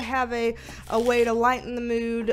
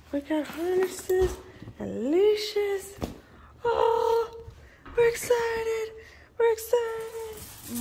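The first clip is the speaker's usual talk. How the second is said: higher, louder, slow sing-song to the dogs; excited pet talk; 3 semitones higher, 3 dB louder, slower than usual. Real, not acted. Owner hyping up her dogs for a walk, higher and excited. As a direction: hype the dogs up about their walk.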